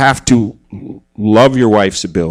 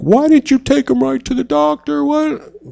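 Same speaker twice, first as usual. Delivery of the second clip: much higher mock wife scolding; mock upset wife. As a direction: voice the wife scolding him about the doctor. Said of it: "she could have been like".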